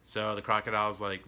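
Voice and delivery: ordinary talk, nothing marked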